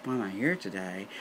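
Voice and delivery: low, soft echo of a question; quiet, quick disbelieving mutter; muttered disbelief. Retold or imitated. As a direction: repeat it under your breath. Echoes doctor's question in disbelief.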